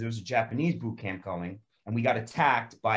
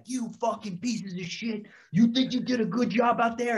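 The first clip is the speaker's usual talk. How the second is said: much higher, strained sergeant tirade; angry drill sergeant; 11 semitones higher than usual. Retold or imitated. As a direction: tear into the recruits like a drill sergeant. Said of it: "he was like".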